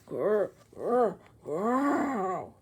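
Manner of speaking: throaty growl; rumbling stomach growl; growling noise. Acted. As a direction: growl like a beast.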